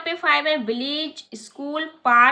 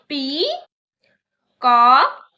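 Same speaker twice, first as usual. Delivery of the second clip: Peacock stretched very slowly and louder, each syllable held; slow loud demo; 8 dB louder, much slower than usual. Shown as a demonstration. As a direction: drag out peacock for the class to repeat. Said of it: "मेरे साथ बोलिए". (Peacock को धीरे और ज़ोर से खींचकर)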